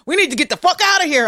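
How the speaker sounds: high, loud, fast urgent line; hurried mock panic; panicked friend. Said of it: "he put the car in drive and be like".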